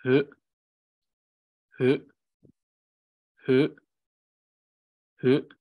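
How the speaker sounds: just a gentle Hı; light h. Shown as a demonstration. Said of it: "just pronounce it as".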